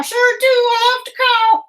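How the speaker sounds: high squeaky puppet bird, eager; Ollie puppet voice. Acted. Puppet bird answering the teacher, high and eager. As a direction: chirp it out like a cheerful puppet.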